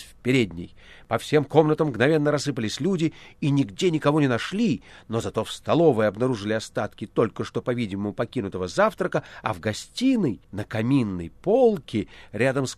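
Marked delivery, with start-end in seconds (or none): none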